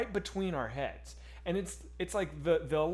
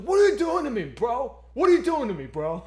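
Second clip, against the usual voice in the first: higher, loud shouted tough-guy rant; angry Brooklyn manager; 6 semitones higher, 9 dB louder than usual. Retold or imitated. Manager scolding him before the restaurant, loud tough-guy shout. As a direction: yell at the diner like a furious Brooklyn boss. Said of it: "he's like".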